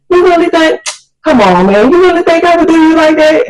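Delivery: put-on high-pitched protesting boyfriend; mock-offended disbelief; incredulous pleading.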